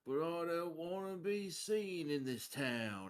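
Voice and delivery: high, slow, stretched-out rhyme; rhymed incantation. Acted. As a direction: intone the verse's closing line. Recites a rhymed plea in character.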